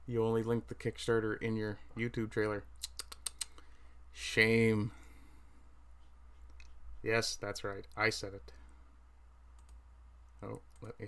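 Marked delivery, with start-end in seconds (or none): 4.1-4.9 mock shaming. Scolding Christopher about missing links.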